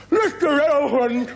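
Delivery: very high, slow, stretched frog cry; wide-mouthed frog calling. Acted. Frog calling up to the big elephant, high and drawn out. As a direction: call up to a giant from far below.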